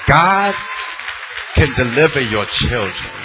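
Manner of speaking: loud, high preacher's cry over the organ; preaching at full force. Acted. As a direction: lift the church with it, slow and ringing. Preacher's climax with music.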